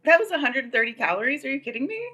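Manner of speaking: high, quick, incredulous squeal; can't believe the number; shocked disbelief. Real, not acted. Shocked by calorie count.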